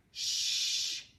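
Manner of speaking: a voiceless "sh" with no voice; whispered sh sound. Shown as a demonstration. Teacher isolating the sh sound. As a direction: hiss out "sh" alone. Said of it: "making the sound of".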